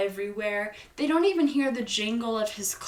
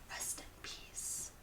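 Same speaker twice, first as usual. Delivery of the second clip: soft whisper fading out; hushed whisper; 17 dB quieter than usual. Real, not acted. Narrator mourns the real dead cat, soft whisper. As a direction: whisper it like a little eulogy.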